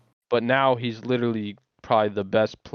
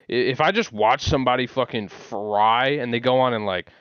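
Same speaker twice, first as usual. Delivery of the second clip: ordinary talk, nothing marked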